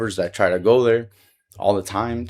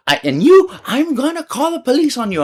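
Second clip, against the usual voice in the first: loud, climbing high-pitched threat; hammed-up screechy warning; imitated screaming threat; 7 dB louder than usual. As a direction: mimic an irate woman yelling. Woman threatens him again.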